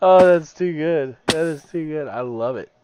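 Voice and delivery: loud, high burst of glee; delighted. Real, not acted. Streamer cracking up at the flying squid. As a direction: crow with delight.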